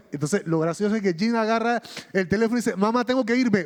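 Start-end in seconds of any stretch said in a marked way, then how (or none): 2.8-3.7 higher, louder rushed excuse to mom; flustered brush-off. Retold or imitated. Jim panicking, cutting his mother off: higher, louder hurried blurt. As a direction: blurt it out and get off the phone fast.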